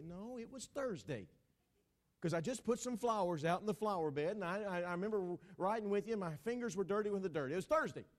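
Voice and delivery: mock marital squabbling; insistent wife. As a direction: argue stubbornly as a put-out wife.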